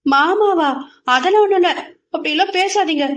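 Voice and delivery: mildly angry. Acted. Girl rejects being called his bride, mildly angry. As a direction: snap back, a little cross. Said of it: "சிறிது கோபப்பட்டாள்".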